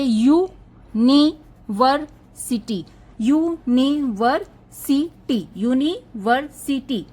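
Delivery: University slowly split as यू, नी, वर, सी, टी; syllable by syllable. Shown as a demonstration. Teacher counting syllables for students, university drawn out beat by beat. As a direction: stress every syllable of university, pausing between them. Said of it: "इसमें एक तो हो गया यू".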